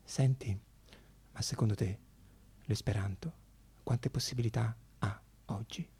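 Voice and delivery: sussurro confidenziale e sommesso; le si avvicina e bisbiglia; a mezza voce. Retold or imitated. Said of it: "a mezza voce mi dice". (l'attrice, vicina vicina, chiede sottovoce)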